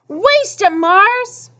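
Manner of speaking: high, rising cheer; root for your runner from the sidelines; crowd cheer. A narrator's reading.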